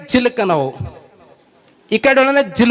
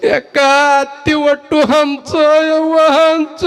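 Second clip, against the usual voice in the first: higher, slow hammed-up wail of a grieving daughter-in-law; mock wailing; 7 semitones higher, slower than usual. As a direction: stretch each cry out like a comic funeral lament. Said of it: "ಅವಾಗ ಬಂದು ಅಳ್ಳಕತ್ತಳು". (ಅಣಕಿಸುವ ಗೋಳಾಟ, ಎತ್ತರದ ಧ್ವನಿಯಲ್ಲಿ ನಿಧಾನವಾಗಿ ಎಳೆದು ಅಳುವುದು)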